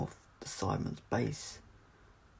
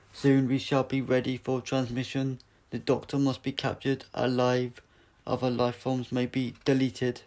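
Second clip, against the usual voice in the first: loud, flat robotic commands; a machine giving cold orders; Cyber leader; 4 semitones higher, 7 dB louder than usual. Acted. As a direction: metallic voice ordering the troops.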